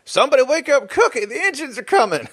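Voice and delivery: high, hollering mock Western yokel; Western camp cook accent. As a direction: raise the alarm as an old-Western camp hand. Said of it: "the camp cook's accent in any Western".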